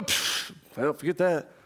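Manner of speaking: a scoffing puff of air, then a low muttered brush-off; dismissive scoff. Retold or imitated. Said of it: "You don't go".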